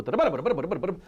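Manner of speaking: "빠라 빠라 빠라" rattled off evenly, no accents; rushed rhythm. Shown as a demonstration. Teacher mocking the student's rushing, quick and even. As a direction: run the syllables together too fast. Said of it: "So don't go".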